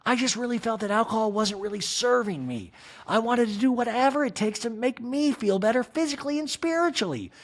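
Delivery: a hint of Kyle's sincerity; Kyle impression. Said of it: "Kyle continues in a talking head".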